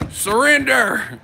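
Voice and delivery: loud, high, drawn-out command; hammed-up SWAT order; mock police shout. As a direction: holler it like a raid at the door. Acting SWAT at his door.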